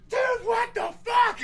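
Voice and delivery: high, loud, drawn-out outraged yell; furious shout. Retold or imitated. Friend finding waterlogged art print, furious shout. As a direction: re-enact a slow furious shout. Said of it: "Like, I was furious".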